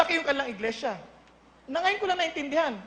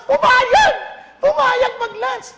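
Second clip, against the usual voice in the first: louder, higher burst of amazement; amazed exclamation; 8 semitones higher, 8 dB louder, much slower than usual. Real, not acted. Preacher telling the church the pastor agreed, amazed and loud. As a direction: shout it out, can't believe he said yes.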